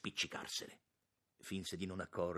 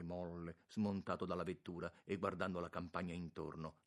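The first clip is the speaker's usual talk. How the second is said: usual speaking voice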